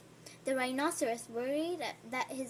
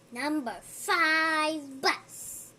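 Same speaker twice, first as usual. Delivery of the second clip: excited; 3 semitones higher, 7 dB louder, much slower than usual. Acted. Reading bus sign excitedly.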